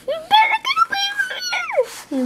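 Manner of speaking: very high squeaky shouted warning with a laugh. Acted. Fireflies squeak a warning at the spider. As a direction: squeak it like a tiny creature.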